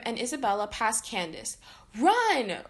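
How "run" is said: warning. A narrator's reading. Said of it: "warned Phineas".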